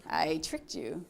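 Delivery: laughing playfully while teasing. Real, not acted. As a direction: chuckle through the words. Teasing the audience after a pause.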